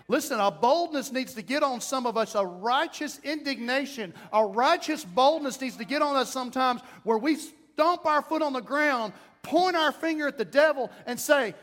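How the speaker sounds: rising, loud preacher's cry; preaching at full force. Acted. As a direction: build it up and preach.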